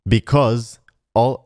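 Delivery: ordinary delivery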